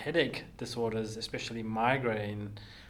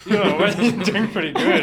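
louder and higher while laughing; 7 semitones higher, 11 dB louder than usual. Real, not acted. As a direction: reassure him through a laugh. Host laughing, reassuring guest.

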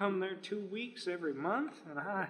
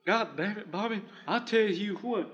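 Texan dad voice; 3 semitones higher, 4 dB louder than usual. Acted. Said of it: "That's really good".

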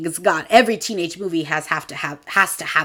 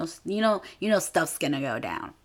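normal talking voice

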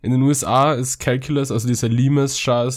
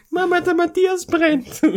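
put-on very high, slow child's cry; child calling mom. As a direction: squeal it like a scared kid.

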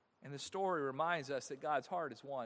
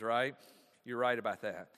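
normal talking voice

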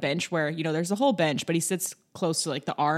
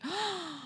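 gasp; 13 semitones higher, 10 dB quieter than usual. Retold or imitated. Strangers stopping her.

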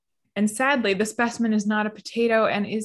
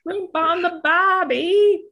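put-on slow, much higher, louder Australian accent; a big cartoon Australian drawl; hammed-up Australian accent; 10 semitones higher, 6 dB louder, slower than usual. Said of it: "Australian mode".

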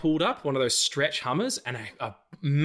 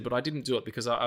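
ordinary talk, nothing marked

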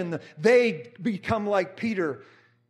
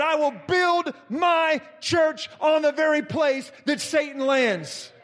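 rising, slow preacher's build to a shout; fiery preaching; 4 semitones higher than usual. Acted. Preaching at full force.

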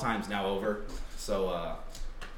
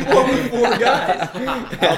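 talking through laughter; laughing; 11 semitones higher, 10 dB louder, slower than usual. Real, not acted. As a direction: laugh while teasing your friend. Laughing at friend's team.

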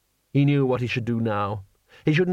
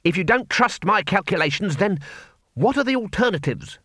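put-on higher, heated young wizard; 6 semitones higher than usual. A narrator's reading. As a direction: snap it out defensively. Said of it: "said Ponder Stibbons hotly".

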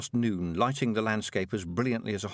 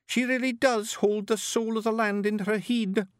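rowdy little Scots pixie voice; broad Scottish accent; 12 semitones higher than usual. Acted. Billy in awe.

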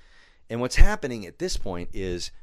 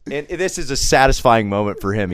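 ordinary talk

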